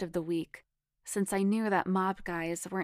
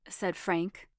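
plain reading voice

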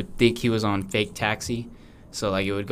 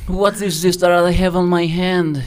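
higher, louder put-on baby; 7 semitones higher, 6 dB louder than usual. Alligator baby questions his parents, put-on voice. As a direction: demand an answer in a mock voice.

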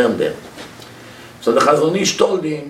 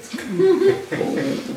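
high mocking laugh; 4 dB quieter than usual.

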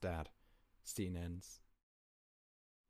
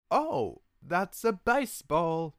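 imitating Edward's dad; 10 semitones higher, 10 dB louder, much slower than usual. Mimicking Carlisle line.

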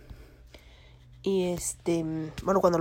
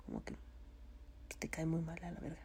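a rude aside kept hushed; fast whispered curse; 2 semitones lower, 14 dB quieter, much faster than usual. Real, not acted. Vlogger swearing about disliked people, hushed and fast. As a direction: rush it out in a whisper.

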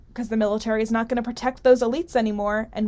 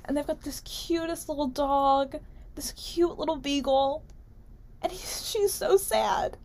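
cooing; 5 semitones higher, 6 dB quieter than usual. Acted. Cooing over the beagle in the ad.

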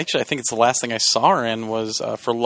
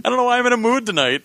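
high-pitched put-on Cockney voice; mock Cockney accent; 11 semitones higher, faster than usual. Explaining the silly voice to co-host, mock Cockney accent. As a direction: play a squeaky, silly Cockney. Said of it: "Cockney-ish".

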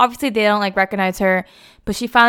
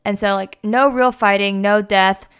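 ordinary talk, nothing marked

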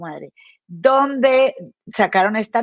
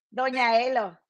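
ordinary delivery

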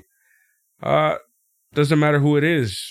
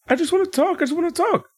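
mocking whine; faster than usual. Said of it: "after all this".

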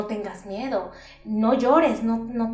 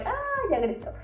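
mock relief; 13 semitones higher, faster than usual. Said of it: "Es como de".

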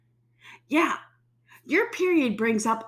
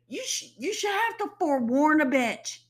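whiny rant; 8 semitones higher than usual. Real, not acted. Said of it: "now I've had my little hissy fit".

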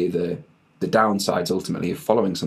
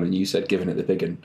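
ordinary delivery

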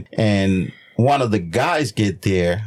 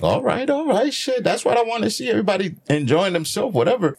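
cheerful approval; 9 semitones higher than usual. Retold or imitated.

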